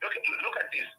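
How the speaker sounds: usual speaking voice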